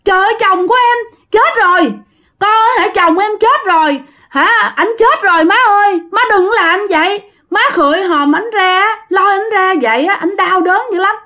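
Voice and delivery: high-pitched acted cry of a widow; wailing protest. A narrator's reading. Wife begs in-laws not to open the coffin, wailing protest. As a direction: plead in distress, voice held high. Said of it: "la làng lên quá".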